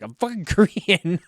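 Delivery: laughing, mock angry shout of I'm fucking Korean; mock angry outburst. Angry quote in a retold joke.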